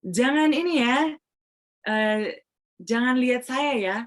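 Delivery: "Jangan" lifted high and drawn out slowly. Shown as a demonstration. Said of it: "Seketika saya bilang".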